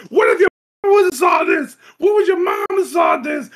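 high, fast shouted outrage; imitated outraged shout. Grandfather yelling at Wendell over the magazines, mock shout. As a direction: yell it like a scandalised grandfather.

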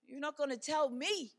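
loud, indignant retort; mock indignation. Mother retelling her angry reply in the spelling fight. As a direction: push back like an offended parent. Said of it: "I was mad".

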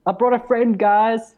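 high, loud proud call; gleeful in-character shout; excited announcement. Acted. Announces new orc friend to party.

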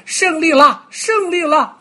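very high, loud gleeful cry; deer cheering. Acted. Deer wins the race and cheers, high and loud. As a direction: crow it proudly, tossing your head. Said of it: "高兴得摇头晃脑".

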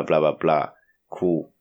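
ordinary delivery

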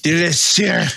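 high, drawn-out, angry-sounding French; hammed-up French accent, hard as German; bad French accent. Egged on by co-hosts to say the name, hammed-up French accent. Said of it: "gonna use my best French accent for this".

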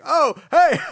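high, loud mock greeting; startled greeting. Turned around to a celebrity, startled hello. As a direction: blurt a hello on turning around. Retelling awkward greeting of star.

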